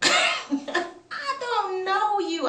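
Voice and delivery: loud, high, drawn-out protest; high, slow, indignant shout; outraged retort. Retold or imitated. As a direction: act out loud disbelief at a stranger. Retold confrontation with stranger.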